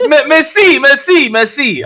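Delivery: mock French merci, high and loud, fading down; a hammed-up French thank-you; French accent. GM trying on the French voice, hammy and high. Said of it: "he was French".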